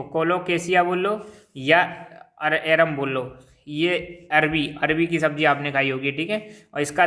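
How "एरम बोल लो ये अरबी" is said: ordinary delivery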